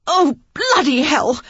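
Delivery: a slow, loud, high curse with a cry; extravagant cursing. A narrator's reading. Lily curses after twisting her ankle, loud and high. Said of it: "her extravagant cursing".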